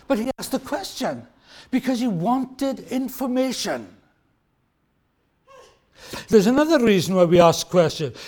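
normal talking voice

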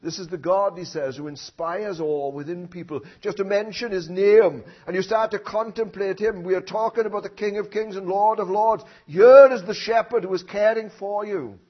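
rising sermon voice; preaching. Acted. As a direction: proclaim it from the pulpit. Opening praise of God.

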